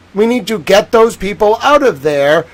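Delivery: ordinary talk, nothing marked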